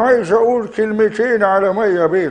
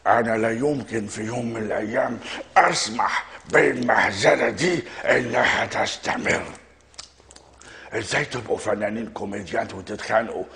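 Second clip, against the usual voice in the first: Gamil Ratib impression; 7 semitones lower, 6 dB quieter than usual. Impressionist voicing a star condemning the farce, low and soft. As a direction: lower and quieter, weighing every word. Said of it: "يا استاذ جميل يا راتب".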